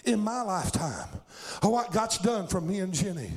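fervent revival preacher in full cry; full-force preaching. Real, not acted. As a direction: preach it hard, driving each phrase to the congregation.

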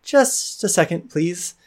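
put-on high young voice, a touch of worry; young woman, concerned. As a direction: lift it high and hurry off politely.

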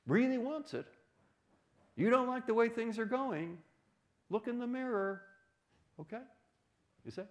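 high-pitched urging, building to loud insistence; emphatic exhortation. Retold or imitated. Professor telling students to participate and convince others, emphatic exhortation.

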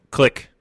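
loud mouth click; imitated click sound. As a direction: say click like a box snapping shut. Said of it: "is that the sound of the box closing".

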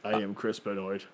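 lightly voiced flat robot voice; deadpan, no charisma at all; robotic monotone. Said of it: "monotone, robotic, uh, voice with no charisma".

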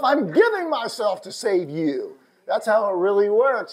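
loud, high-pitched preacher's cry; impassioned preaching. Real, not acted. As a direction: drive it home to the congregation at full voice. Preaches at full force.

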